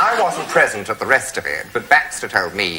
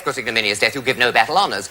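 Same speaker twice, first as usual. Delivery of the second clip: normal talking voice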